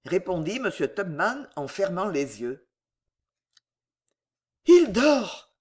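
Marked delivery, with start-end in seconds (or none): none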